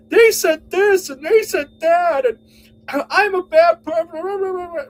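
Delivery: high, loud, slow whine; mock whining victim. Mocking Joe playing the victim, high and whiny. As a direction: pretend to be hard done by.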